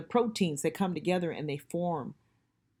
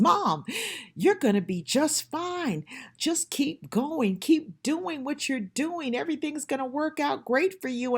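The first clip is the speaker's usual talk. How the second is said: gently reassuring as her son, pitched up; son's voice; 8 semitones higher, 4 dB louder than usual. Retold or imitated. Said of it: "my son says".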